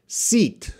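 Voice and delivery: long ee in "seat", lips spread wide. Shown as a demonstration. Teacher modelling "seat" as a long sound. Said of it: "it's a long sound".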